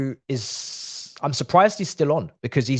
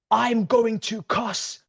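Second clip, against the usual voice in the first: drawn out word by word, higher pitched; slow, emphatic exasperation; 5 semitones higher, much slower than usual. Real, not acted. Fan repeating the threat, slowly exasperated. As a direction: spell out GOING TO slowly and fully.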